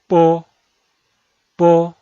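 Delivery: po with a loud puff of air; aspirated p. Shown as a demonstration. Teacher shows aspirated po loudly to learners. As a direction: blow a strong burst of air on po. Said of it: "ese se pronuncia".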